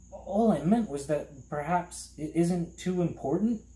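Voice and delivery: put-on high, tentative boy's explanation; politely hesitant. A narrator's reading. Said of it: "trying not to be impolite".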